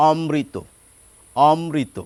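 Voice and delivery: অমৃত as om-mri-to, m doubled; natural Bengali double-m অমৃত; doubled consonant. Shown as a demonstration. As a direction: hold the m in অমৃত across two syllables. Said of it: "double উচ্চারিত হয়".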